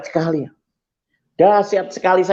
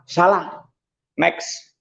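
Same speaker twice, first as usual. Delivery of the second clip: ordinary delivery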